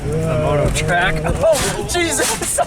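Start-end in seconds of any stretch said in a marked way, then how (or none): 1.2-2.6 high shocked yelp; startled exclamation. Real, not acted. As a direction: blurt it out in alarm. Exclaiming on a jump.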